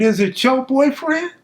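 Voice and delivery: high, incredulous parroting of the question; mocking echo. Suspect mocking the deputy's question, high and incredulous. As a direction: throw the deputy's words back at him, mocking.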